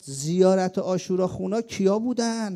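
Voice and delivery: loud, slow, high battlefield cry; a young fighter's shout rallying the battalion; retold shout. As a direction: shout it out over the gunfire. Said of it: "یه دفعه داد زد".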